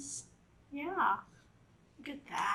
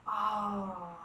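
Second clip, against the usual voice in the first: plain reading voice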